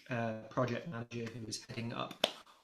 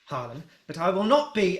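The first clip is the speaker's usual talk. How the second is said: stiff, offended Osric; 6 semitones higher, 8 dB louder than usual. Acted. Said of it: "and he goes".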